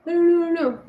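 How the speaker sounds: a hint of child crying; imitating crying. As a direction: whimper the no's like a scared child. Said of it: "she started crying".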